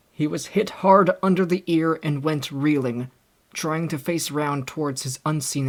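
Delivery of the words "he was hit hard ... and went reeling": ordinary delivery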